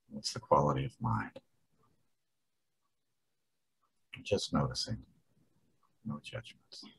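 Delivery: soft, unhurried guidance with long pauses; calm meditation prompts, trailing softly; guided meditation. Real, not acted. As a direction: gently invite them to check in, leaving space between questions. Leads a short settling meditation.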